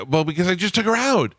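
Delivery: high, squeaky indignant whine; exasperated protest. Retold or imitated. Husband protesting to his wife about the dog, high exasperated whine.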